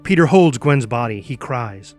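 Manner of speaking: ordinary talk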